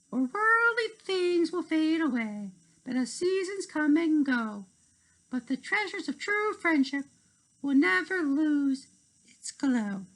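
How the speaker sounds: high, sing-song closing verse; poem recital. Acted. Narrator closing the story, high lilting rhyme.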